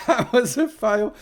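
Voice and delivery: loud, high, slow words through laughter; laughing. Real, not acted. Streamer laughing at his own fail, laughing. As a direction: crack up at your own flop.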